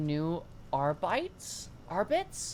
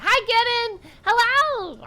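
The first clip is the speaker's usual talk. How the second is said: high, loud cheery hello; excited greeting; 9 dB louder, much slower than usual. Real, not acted. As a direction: greet a friend with glee. Greeting a chatter.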